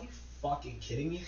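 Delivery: hushed, incredulous mutter; reenacted under-the-breath disbelief. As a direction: drop to a near-silent grumble. Muttered disbelief at neighbors' displays.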